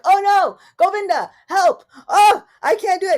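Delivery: loud, high whiny cry for help; panicked plea. Retold or imitated. Speaker mocking herself begging Govinda, loud and whiny. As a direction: beg Govinda like a helpless child. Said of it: "and then I'm like".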